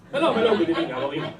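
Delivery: natural Israeli accent, pitch dropping at the end. Shown as a demonstration. Comic greets the crowd in his natural accent, Israeli accent. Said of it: "use my natural Israeli accent".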